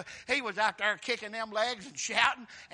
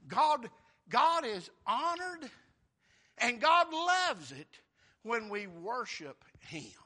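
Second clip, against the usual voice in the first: slow, raised proclamation; emphatic preaching; 3 semitones higher, 6 dB louder, slower than usual. Acted. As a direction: lean on every word.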